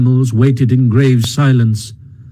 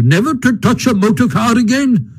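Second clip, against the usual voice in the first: indignant challenge; 11 semitones higher than usual. A narrator's reading. Badger challenges unrepentant Toad.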